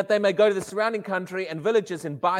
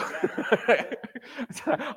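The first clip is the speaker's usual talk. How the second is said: laugh; 7 semitones higher than usual. Real, not acted. Laughing at himself.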